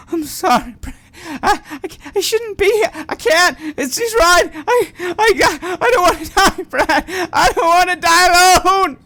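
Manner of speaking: high, loud, crying plea; sobbing in terror, voice cracking high; panicked sobbing. Acted. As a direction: beg through tears, terrified of dying.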